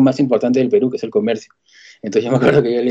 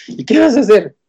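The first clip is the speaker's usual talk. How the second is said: imitated sister, high and louder at the turn; pressing question; 10 semitones higher, 4 dB louder than usual. Sister asks brother what his job is, pressing. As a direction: suddenly pitch up the curious question.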